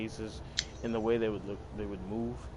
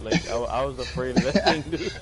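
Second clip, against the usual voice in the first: laughing; 3 semitones higher, 7 dB louder than usual. Real, not acted. Laughing over childhood fear.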